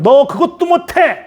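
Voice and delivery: high, drawn-out belittling rebuke; scolding. Acted. Imagined harsh God to someone who failed, scolding. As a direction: berate them as useless, pitched high and slow. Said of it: "이렇게 야단치는 하나님".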